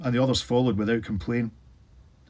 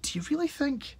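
softer, higher, awed hush; hushed, frightened question; hushed whisper; 6 dB quieter than usual. A narrator's reading. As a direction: breathe it out in dread. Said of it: "Alan whispered".

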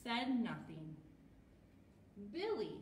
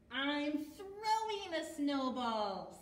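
slow, loud, higher character call; Billy's voice; 4 semitones higher, 5 dB louder, slower than usual. A narrator's reading. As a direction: announce it loudly, stretching the words. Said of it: "said Billy".

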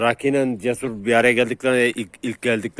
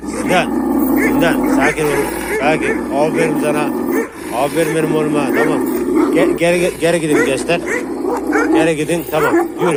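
raised, higher voice over the dogs; urgent commands; 4 semitones higher, 4 dB louder than usual. Real, not acted. Owner calming dogs and ordering them back, urgent commands. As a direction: take control, loud and insistent.

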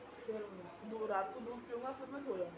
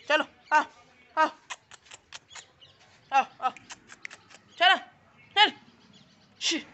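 higher, louder coaxing calls; calling puppies; 6 semitones higher, 13 dB louder, slower than usual. Real, not acted. Vlogger coaxing street puppies to come, loud calls. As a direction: call the pups over, short and bright.

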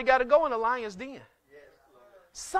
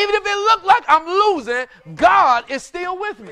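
full preaching shout; 5 semitones higher, 9 dB louder than usual. Real, not acted. Preacher repeating his point to the congregation, shouted. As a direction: drive it home to the church.

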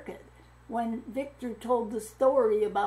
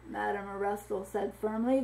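plain reading voice

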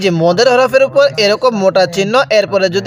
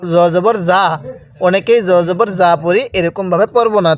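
normal talking voice

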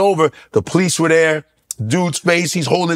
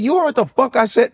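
challenging; 9 semitones higher than usual. Retold or imitated. Squaring up to heckler.

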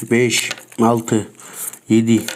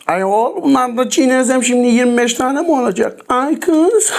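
much higher, exasperated whining; exasperated exclaim; 10 semitones higher than usual. Real, not acted. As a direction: throw up your hands in disbelief. Exasperated at twenty-five gums.

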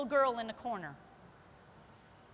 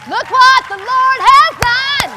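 very loud, high, slow shout of praise; exultant shout; 11 semitones higher, 20 dB louder, slower than usual. Real, not acted. Preacher praising the Lord before the church, shouting. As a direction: shout it out to the rafters.

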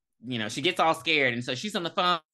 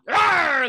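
higher, louder hammed-up yell; mock scream; 10 semitones higher, 9 dB louder than usual. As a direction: let out a startled cartoon yell. Retold scream at the door.

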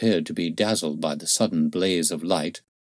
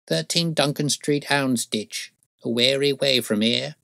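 put-on higher-pitched old woman's voice; high-voiced old crone; weary old crone; 8 semitones higher than usual. A narrator's reading. Old crone gives her address.

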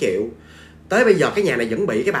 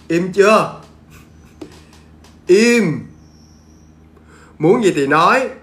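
loud firm orders; stern command; 3 semitones higher, 6 dB louder, slower than usual. Retold or imitated. Mother ordering the ghost to speak, loud. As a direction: scold the spirit into silence. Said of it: "Mẹ của bạn của chị nó bảo".

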